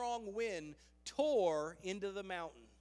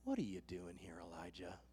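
very low, soft divine question; hushed, low-pitched probing; voice of God; 13 semitones lower, 9 dB quieter than usual. Acted. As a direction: sink deep and quiet as the Lord. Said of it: "the voice came to him and said".

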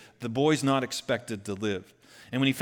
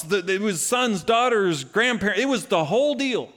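much higher, louder fired-up preaching; preaching at full force; 7 semitones higher, 5 dB louder than usual. Acted. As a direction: rouse the congregation with the good news.

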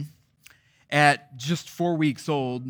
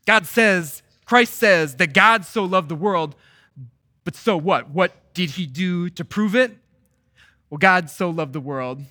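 rising, louder pulpit cry; full-force preaching; 4 semitones higher, 6 dB louder than usual. Acted. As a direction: preach it out with mounting fervor. Preaching answer to talk is cheap.

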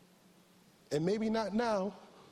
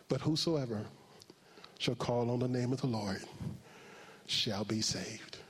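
solemn scripture reading; 5 semitones lower than usual. Acted. Reads scripture.

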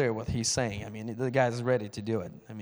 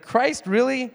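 high, loud, scoffing question; pitched-up mocking challenge; mock disbelief; 11 semitones higher, 5 dB louder, slower than usual. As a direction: imitate an incredulous scoffer. Retells the other man's scoffing.

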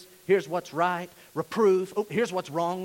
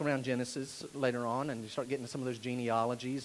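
normal talking voice

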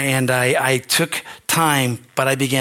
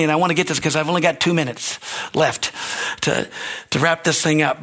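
usual speaking voice

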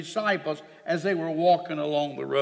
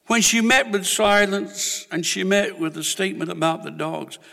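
ordinary delivery

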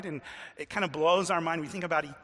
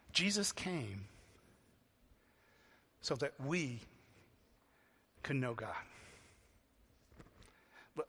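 soft and low, long pauses between phrases; hushed, reflective; 6 semitones lower, 9 dB quieter than usual. Real, not acted. Preacher asking listeners to personalize that, hushed and reflective. Preacher lets the point sink in.

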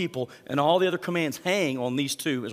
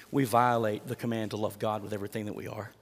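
ordinary talk

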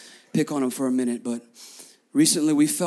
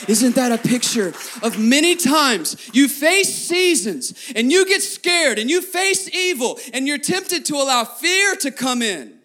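preaching at full force; 5 semitones higher than usual. Acted. Pastor urging the congregation to trust Jesus, full-force preaching. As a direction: lift the church up to Jesus.

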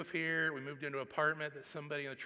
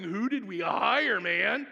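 loud, slow, mock-dismayed churchgoer; mock church member; 4 semitones higher, 10 dB louder, slower than usual. Hammed-up complaint, drawn out. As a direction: congregation grumbling to Brad about the new hire, loud mock dismay. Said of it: "you guys calling Brad going".

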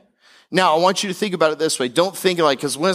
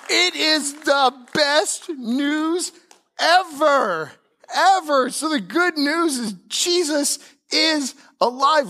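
high, slow, soaring proclamation; full-force preaching; 10 semitones higher, slower than usual. Acted. Pastor crying out the good news, high and slow. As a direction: lift it up like the best news ever.

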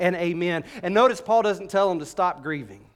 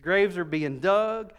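usual speaking voice

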